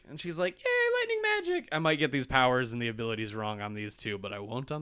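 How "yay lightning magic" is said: very high, gleeful squeal; mock delight. Girl learning her new power, mock delight. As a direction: cheer like a thrilled girl.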